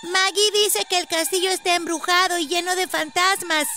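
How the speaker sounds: put-on high child's voice; a kid passing on a spooky rumour; high-pitched storytelling child; village child. A narrator's reading. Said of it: "agregó uno de los niños".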